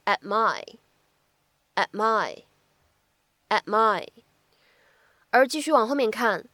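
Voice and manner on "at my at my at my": at my said slowly with the t held; incomplete plosion. Shown as a demonstration. 老师慢速示范 at my 的不完全爆破. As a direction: at my, t unreleased into my. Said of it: "可以读成是".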